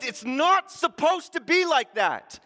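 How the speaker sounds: loud, high, indignant cry against death; furious preacher voicing Jesus at the grave; angry outcry. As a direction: let the anger burst out.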